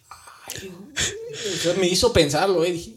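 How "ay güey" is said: breathless whisper that jumps high on güey; a hushed, shaken gasp; awed whisper. Retold or imitated. Told each cross is a death, he gasps in a hushed whisper.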